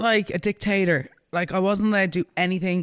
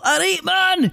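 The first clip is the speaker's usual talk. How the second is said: mock Scottish greeting; 10 semitones higher, much slower than usual. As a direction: toss off a hammy Scottish hello.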